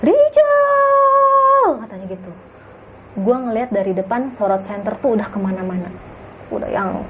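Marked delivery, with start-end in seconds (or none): 0.0-1.8 loud, much higher panicked shout of a name; yelled name. Retold or imitated. Cousin screaming for Rijal in the dark, loud panicked yell. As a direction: call out across the dark forest trail. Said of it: "Dia teriak".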